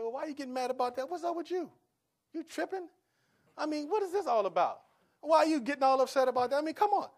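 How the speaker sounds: high, fast, indignant complaining; mock indignant retort. Husband shows the wrong reply to his wife, mock indignant. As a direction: imitate picking a fight. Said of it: "could have sit back and said".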